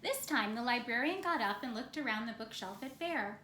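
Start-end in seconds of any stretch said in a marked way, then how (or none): none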